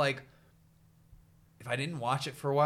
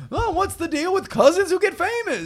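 very high, loud standup whine; a Seinfeld-style observational bit, pitched up; Gary Seinfeld voice; 10 dB louder than usual. Pretend. Comedian pitching his character Gary Seinfeld, loud standup whine. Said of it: "I'm his cousin, Gary Seinfeld".

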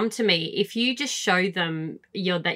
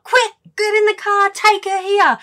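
high, loud frantic panic; mock panic, much higher and louder; panicked; 13 semitones higher, 5 dB louder than usual. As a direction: yell it in a panic over the dog. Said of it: "lost the plot".

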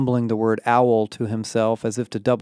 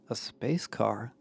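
puzzled repeat; 10 dB quieter, slower than usual. A narrator's reading. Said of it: "Connor repeated, squinting at Emma".

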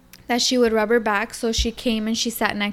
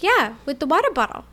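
put-on higher little-girl voice; imitated small child; 5 semitones higher, faster than usual. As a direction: answer like an innocent child. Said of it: "And she's like".

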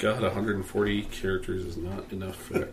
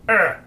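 loud, high pained yelp; short acted yell of pain; cry of pain; 8 semitones higher, 7 dB louder than usual. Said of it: "crawls out in pain down the hall".

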